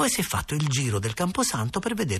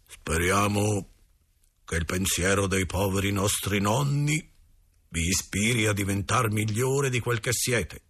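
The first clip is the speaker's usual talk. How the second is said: slow, solemn paternal admonition; grave, stern father. A narrator's reading. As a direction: intone it like a father still cross with his child. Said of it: "con quella voce grave che fa sempre". (voce grave e solenne del babbo)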